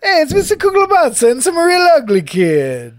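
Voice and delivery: high, loud cartoon Italian, laughing; mock Italian accent. Podcaster quoting the Simpsons waiter, laughing Italian accent. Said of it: "Italian accent".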